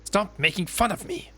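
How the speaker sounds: high, loud stop making fun; angry outburst. Acted. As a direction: snap back at the brother. Sister snaps at brother.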